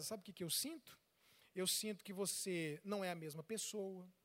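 soft, low heartfelt confession; mother opening her heart to her son; tender mother. Retold or imitated. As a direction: speak your hurt gently to your son. Mother sharing her feelings softly.

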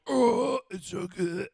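loud mock moan of food bliss; hammed-up swooning delight; mock ecstasy. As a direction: groan it out like diners overcome by flavor. Mimicking diners overcome by food.

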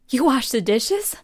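gasp, then very high slow oh honey; husband amazed she washed the dishes; mock amazed gasp. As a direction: overdo his amazement.

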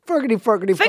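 high mock-Irish drill; Irish accent warm-up. Shown as a demonstration. Urging the other to try the trick, high Irish warm-up.

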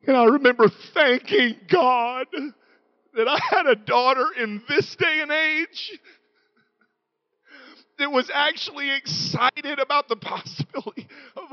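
choked up. Real, not acted. Father grateful for his daughter, voice breaking with tears.

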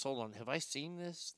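usual speaking voice

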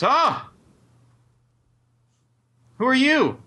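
high, startled yelp into a sharp question. Acted. Banker jumps as visitors step into the room, startled. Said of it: "he's very startled".

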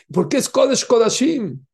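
emphatic question. Real, not acted. Preacher to congregation, loud rousing question. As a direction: push ¿Por qué? out to rouse them.

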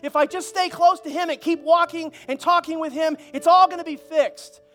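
high, loud, emphatic declaration; defiant preaching. Acted. Tenant tells old landlord he's under new management, defiant. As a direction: stand up to the bully with full pulpit force.

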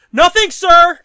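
loud, high mock-respectful reply; mock hasty deference. Junker backing down before the dad, loud mock deference. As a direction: back down loudly as the junker.

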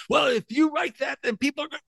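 high-pitched mimicked objection; mock worrying. Mimicking cautious activists' objection.

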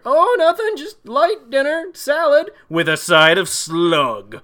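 high, loud, slowed-down fake-sweet wife; sly wife voice. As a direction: sing it out innocently, then drop for the slug. Voicing wife serving slug salad.

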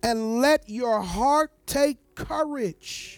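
slow, weighty scripture reading; emphatic scripture reading. Acted. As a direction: read each word slowly with weight.

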